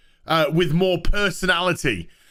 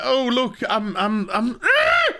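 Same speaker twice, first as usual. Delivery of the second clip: frustrated sputter; 6 semitones higher than usual. Real, not acted.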